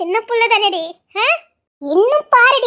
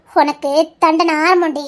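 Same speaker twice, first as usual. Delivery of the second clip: menacing; 7 semitones lower, slower than usual. Acted. Threatening her with punishment.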